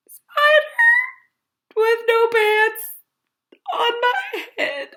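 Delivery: very high squealing panic; panicked squeal. A narrator's reading. Figure shrieking about a spider on their head, panicked. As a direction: shriek it with laughing terror.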